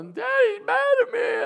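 put-on high wailing child's cry; crying kid. Dad imitating his son wailing after being pulled back, high crying shout. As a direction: howl it like a tearful six-year-old. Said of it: "Starts screaming and crying".

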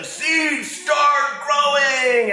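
very high, drawn-out shout; shouting Toad. Acted. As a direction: yell at the seeds to grow. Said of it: "he shouts at them".

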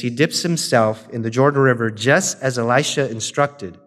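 ordinary talk

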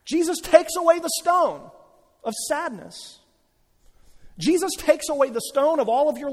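high, loud, ringing proclamation; impassioned sermon climax, pitched high and loud; full-force preaching. Acted. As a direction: preach it out at full volume, building to a triumphant peak.

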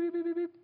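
high sung bleeps covering the swearing; mock censor bleeps. Preacher bleeping out an angry tirade, high mock bleeps. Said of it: "you're saying something like".

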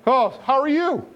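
high, chummy voice of a calm sheep; a lightly silly sheep saying sure, go ahead; relaxed sheep voice. Retold or imitated. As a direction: play the sheep, easygoing and cheerful. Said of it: "the sheep's like".

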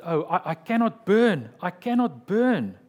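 a condemned man's loud, anguished cry; retold desperate shout. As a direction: shout it in dread before the stake. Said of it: "he shouted".

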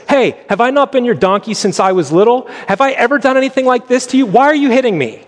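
high, pleading donkey voice; a wronged donkey complaining to its master; talking donkey. Acted. The donkey speaks to Balaam.

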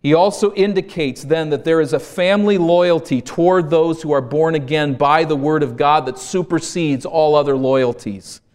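ordinary talk

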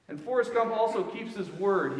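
normal talking voice

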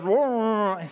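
high, drawn-out wordless mumble; mumbling. Retold or imitated. Kid answering the fisherman with a mouthful, mumbling. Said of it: "kid just kinda mumbles back".

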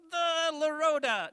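high, loud, stretched-out puppet voice; misreading puppet. Pretend. Puppet trying the word, high slow loud attempt. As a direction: slowly sound out nonsense as a puppet. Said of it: "No, not the lapatodon".

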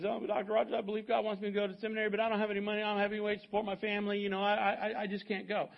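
imitating an anxious seminary hopeful; pleading young man. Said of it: "And the, and the guy says".

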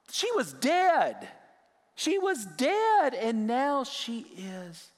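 put-on preacher marvelling that the girl was dead; amazed emphasis. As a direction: stretch each word in wonder. Marvels at the miracle.

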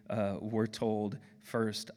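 ordinary talk

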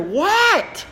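acting out an alarmed parent's answer; exasperated parent. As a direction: call back sharply, pitched high. Parent answering the screaming child.

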